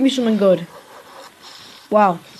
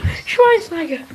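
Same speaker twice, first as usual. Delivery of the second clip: slow, high, thrilled cry of the name; excited, drawn out; 8 semitones higher, slower than usual. Real, not acted. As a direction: stretch the name out in delight. Great player pulled.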